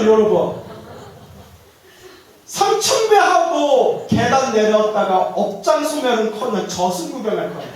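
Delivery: ordinary delivery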